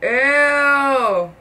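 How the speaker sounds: speaking with mouthpiece in; pulling tray from mouth. Real, not acted. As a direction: teeth tray half removed. Seen 0: Tray at mouth, being pulled out until 1.4; out afterwards.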